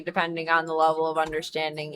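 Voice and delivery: usual speaking voice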